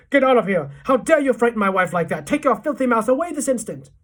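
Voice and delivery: loud, furious bellow; shouting. A narrator's reading. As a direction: roar orders at the intruder. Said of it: "towering over us and shouting".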